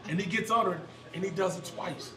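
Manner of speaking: usual speaking voice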